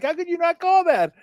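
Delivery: high-pitched mock yell at the TV; retold yelling. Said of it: "I was yelling at the T V".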